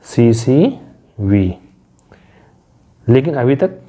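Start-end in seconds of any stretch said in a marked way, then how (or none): 0.1-1.5 C, V, C drawn out one letter at a time; letter-by-letter teacher spelling; spelled out slowly. Shown as a demonstration. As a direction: spell the pattern slowly for students. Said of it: "ये भी pattern होता है".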